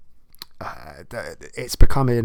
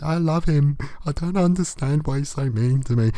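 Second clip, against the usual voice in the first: put-on higher, whiny girlish voice; higher mock girlish pleading; mock lovesick girl; 4 semitones higher than usual. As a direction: gush like a smitten young lady. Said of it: "she's like".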